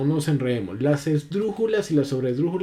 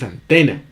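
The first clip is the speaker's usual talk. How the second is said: antena with a firm, louder TE, an-TÉ-na; stress on middle syllable; 7 dB louder than usual. Shown as a demonstration. Teacher showing students the real stress of antena, an-TÉ-na. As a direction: lean on the "te" in antena. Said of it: "es una palabra grave".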